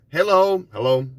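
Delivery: clipped, perfunctory hello, high first; curt hello. Retold or imitated. Mock curt greeting to a stranger. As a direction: brush someone off with a quick hello.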